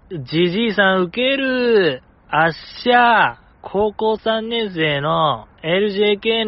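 slow, drawn-out gal delivery in standard Japanese; gal-style letter reading. Acted. Podcast host reading out a listener's cheeky complaint, gal-style. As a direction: read it like a gal, stretching the phrase endings. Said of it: "なんとなしのギャル感で読みましたけども".

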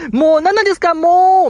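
imitated flustered wail; mock exasperated protest. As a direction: act out a teased girl protesting. Acts out teased member protesting.

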